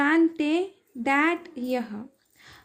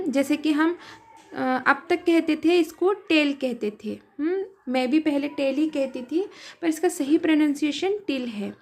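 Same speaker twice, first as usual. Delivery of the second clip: ordinary delivery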